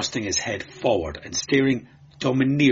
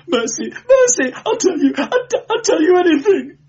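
high, loud sobbing surrender; moaning victim; 7 dB louder than usual. A narrator's reading. Beaten clerk offering to talk, wailing plea. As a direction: plead desperately, voice climbing to a wail. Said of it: "moaned the victim".

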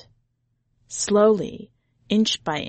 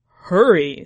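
louder urgent tiger; urgent hurry; 8 dB louder than usual. A narrator's reading. Tiger urges the boy as searchers near, louder. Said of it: "said the tiger".

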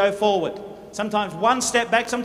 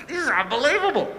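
snorting disbelief; 3 semitones higher than usual. Pretend. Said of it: "He gets the snorts".

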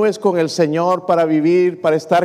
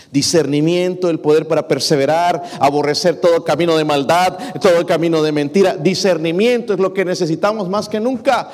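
full-force preaching; 5 semitones higher than usual. Acted. Pastor urging the church to seek discernment, rising preaching. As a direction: drive it home to the congregation. Preaching climax, louder and higher.

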